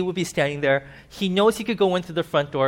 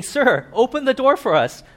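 pleading; 8 semitones higher, 5 dB louder than usual. Acted. People pleading at locked door.

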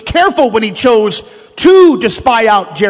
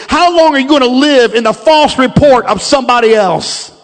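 rising preacher's exhortation, a bit louder; preaching; 3 semitones higher, 5 dB louder than usual. Acted. Pastor pleading with the church to leave doubt behind, fervent preaching. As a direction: press the congregation hard, building to a peak.

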